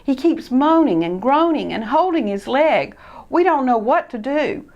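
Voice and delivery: high, loud breathless news; urgent alarm. Acted. A friend running up to report the crash. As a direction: rush to raise the alarm.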